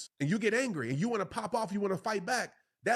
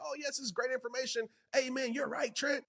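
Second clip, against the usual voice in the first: mock eager listener; 8 semitones higher than usual. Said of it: "I hope you're not listening and saying".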